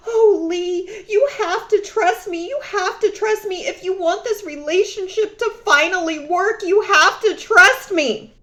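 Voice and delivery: high, whiny pleading with a fake sob; mock crying ex. Mocking her crying ex begging for trust, high and whiny. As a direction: ham up his teary begging. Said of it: "this person crying".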